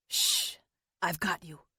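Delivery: quiet, near-whispered reassurance; soft shh, then tender words; soft hush and comfort. Acted. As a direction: hush her gently as she falters. Steadying faltering April.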